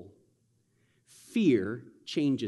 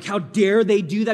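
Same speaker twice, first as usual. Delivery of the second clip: higher, louder mock outrage; indignant; 5 semitones higher, 6 dB louder than usual. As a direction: retell the outrage with a raised voice. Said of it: "I'm like".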